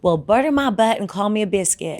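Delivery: folksy American Southern belle; Southern belle accent. Acted. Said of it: "That's what they say".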